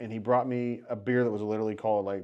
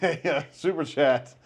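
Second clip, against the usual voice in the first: usual speaking voice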